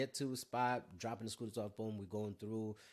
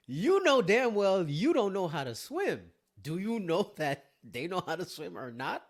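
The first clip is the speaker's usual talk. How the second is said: self-scolding; 7 semitones higher, 10 dB louder than usual. Pretend. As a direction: drag the words out like a disbelieving scold. Scolding himself in hindsight.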